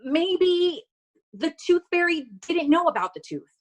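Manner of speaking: high mom voice, searching for an excuse; reassuring mom. Acted. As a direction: pitch it well up, a mother consoling her child. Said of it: "she said".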